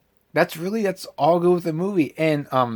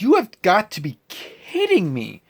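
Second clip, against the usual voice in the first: disbelief; 5 semitones higher than usual. Retold or imitated. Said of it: "I was like".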